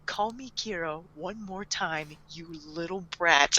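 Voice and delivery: very soft, menacing undertone; hushed, low-key mock menace; retold quiet threat. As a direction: mutter a warning under your breath.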